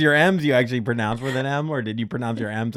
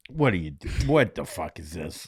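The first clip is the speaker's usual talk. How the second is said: low incredulous; 7 semitones lower, 4 dB quieter than usual. Retold or imitated. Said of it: "everyone's like".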